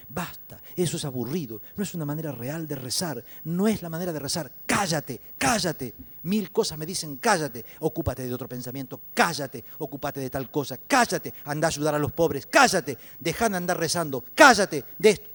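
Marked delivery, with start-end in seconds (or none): 0.1-5.9 mounting loud rebuke; scolding. Acted. Inner voices telling the praying man to stop, loud scolding. 7.2-15.3 loud scolding, building to a shout; hectoring. Acted. Nagging thoughts silencing a man at prayer, escalating shouts.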